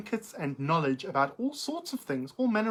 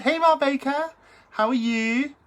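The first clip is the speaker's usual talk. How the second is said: excited greeting; 8 semitones higher, 6 dB louder than usual. Real, not acted. Excited greeting to old friend.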